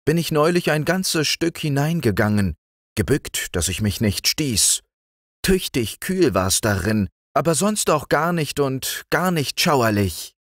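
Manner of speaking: put-on high, bright child voice; cheerful young girl talking, pitched well up; little girl Liesel. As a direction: speak up like a fearless little girl.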